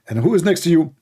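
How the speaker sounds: usual speaking voice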